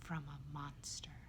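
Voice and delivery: slow, hushed near-whisper; dropped voice. A narrator's reading. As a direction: confide it low and slow, in dread. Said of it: "Bob drops his voice".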